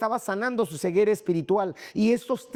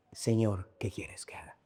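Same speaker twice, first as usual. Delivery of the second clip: low, hushed prayer fading to a whisper; whispered surrender; 8 semitones lower, 8 dB quieter than usual. Acted. Preacher modelling how we ask the Lord, whispered submissively. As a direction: whisper it humbly to God.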